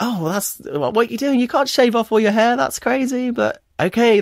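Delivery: high-pitched incredulous protest; retold disbelief. Son reacting to dad shaving his head, high-pitched disbelief. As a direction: act out a child baffled by a parent's plan, voice pitched well up.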